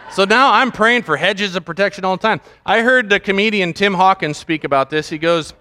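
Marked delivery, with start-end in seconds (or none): none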